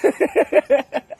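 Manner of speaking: high, chuckling laugh; amused laughter. Real, not acted. Questioner laughs at the flustered man's answer, amused laughter. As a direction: burst into amused giggles.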